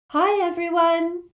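high, slow, sing-out greeting; happy. A narrator's reading. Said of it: "said Luna happily".

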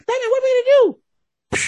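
high, loud sidekick squeal; high-pitched mock panic; excited sidekick. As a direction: yell it like an eager young sidekick. Excited kid sidekick voiced in retelling.

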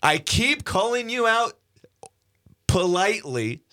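high-pitched mock-indignant callout; calling someone out. Mock challenge called out on air.

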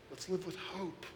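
soft, private promise of hope; hushed plea. Real, not acted. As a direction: say it under your breath first. Repeats line, first hushed.

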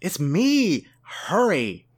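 high, urgent cry; urgent plea. Acted. Trapped ferret urging Cassie to free it, urgent. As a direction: plead for quick rescue. Trapped character urges friend to hurry.

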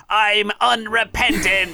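high defiant little elf voice; dying elf, defiant to the end; christmas elf. A narrator's reading. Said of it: "his final words are".

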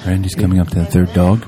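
low-pitched mock golf commentary; golf commentator. Co-host joins the golf joke, mock commentator. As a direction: drop the pitch like a golf announcer. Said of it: "kind of like a golf event".

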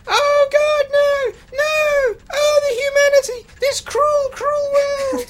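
very high, loud terrified shrieks; tiny screaming voices. Pretend. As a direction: shriek in tiny panic as if being cooked. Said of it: "It's like a thousand little screams".

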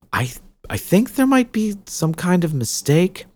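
put-on a young girl, unsure and polite; lightly voiced young girl. Young witch Jill, unsure.

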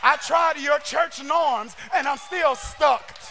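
loud, high, shouted preaching cadence; preaching at full force. Acted. Preacher shouting to the congregation, full-force sermon delivery.

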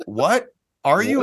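high, startled questioning; incredulous judge. Retold or imitated. Judge reacting to the kid's confession, incredulous.

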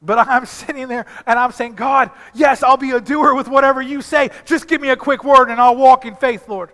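loud, high preaching build; fervent sermon, voice climbing; preaching at full force. Acted. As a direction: lift the congregation with it. Preaching climax.

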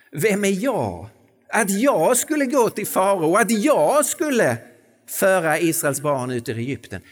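a humble man protesting his calling; doubting Moses. Acted. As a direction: cry out in self-doubt.

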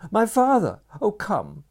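high, loud desperate urging; frantic pleading. A narrator's reading. Girl urging the stranger up the steps, high and desperate. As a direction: beg him to hurry, almost crying.

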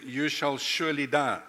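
normal talking voice

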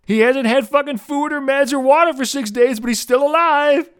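high, put-on voice of an oblivious fan; high-pitched mocking quote; mocking imitation. Mocks a fan's Twitter post.

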